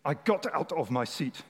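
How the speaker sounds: put-on measured German accent; Reinhard Bonnke. German preacher retelling leaving his seat in church, German accent. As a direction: tell it deliberately in a German accent. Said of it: "This is not how I talk, it's how he talks".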